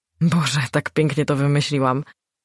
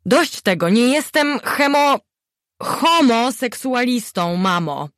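high, slow, louder exasperated son; exasperated outburst; 8 semitones higher, 4 dB louder, slower than usual. A narrator's reading. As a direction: finally snap at mother. Syn wybucha na matkę. (wysoki, rozdrażniony wybuch syna)

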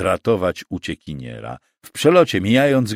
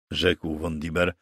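plain reading voice

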